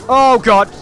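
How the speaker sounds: loud high gasp of shock; startled cry. Real, not acted. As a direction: blurt it out in fright. Sudden scare.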